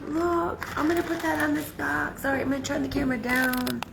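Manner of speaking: high, tight voice on the edge of tears; a real emotional wobble, held together; teary voice. Real, not acted. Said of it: "I'm so emotional sometimes".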